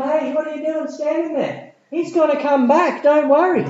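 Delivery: high, loud, excited preaching; preaching at full force. Acted. Preacher rousing the congregation with a big, high, loud voice. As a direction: lift the crowd, rising high and loud.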